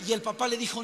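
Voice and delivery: ordinary talk, nothing marked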